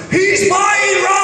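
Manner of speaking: high, slow mock yell; yelling across the store. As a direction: bellow it across a shop to embarrass a friend. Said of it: "he yells to Tim from across Shoppers".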